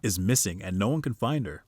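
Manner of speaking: ordinary talk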